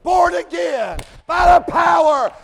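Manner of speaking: loud, high, slow shouted preaching; full-force preaching. Acted. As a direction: rise into a hollering sermon climax.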